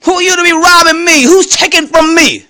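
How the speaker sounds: high-pitched, indignant confrontation; worked-up, affronted challenge. Retold or imitated. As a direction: demand answers like you've been robbed. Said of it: "My attitude is".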